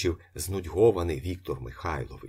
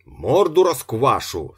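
loud, slow, raised-pitch threat; heated threat; 5 semitones higher, 9 dB louder than usual. A narrator's reading. Worked-up janitor threatening the locksmith, loud and angry. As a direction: shout it as a drawn-out threat. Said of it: "двірник розпалювався ще дужче".

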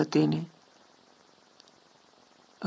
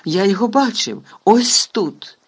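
higher, louder insisting; insistent anger; 5 semitones higher, 5 dB louder than usual. A narrator's reading. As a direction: insist hotly, pointing. Executioner insisting angrily.

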